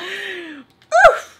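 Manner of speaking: high, loud "oof"; sudden mock-wounded yelp; mock pained oof. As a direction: react as if jabbed in the kidneys. Mock reaction to a kidney stab.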